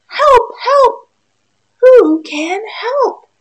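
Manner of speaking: high, loud cry for help; a stuck duck yelling high and loud; squeaky desperate duck shout; duck calling for help. Acted.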